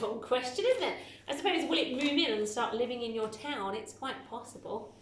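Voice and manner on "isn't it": amused surprise. Real, not acted. Comments on strange sentence.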